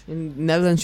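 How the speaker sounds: usual speaking voice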